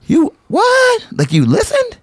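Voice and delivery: shocked disbelief. Retold or imitated. Camp teacher hearing kids repeat him, shocked. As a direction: act floored that the kids listened. You're like gasps.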